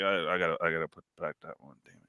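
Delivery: ashamed under your breath at the register; embarrassed mumble. Retold or imitated. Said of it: "all embarrassed".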